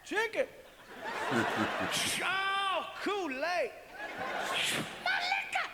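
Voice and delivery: high, gleeful delighted cries; mock excited shouts. Man unpacking a gift basket, high and thrilled. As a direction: go giddy over each item.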